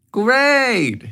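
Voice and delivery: high, bright great; thrilled she got her way; pleased mom. A narrator's reading.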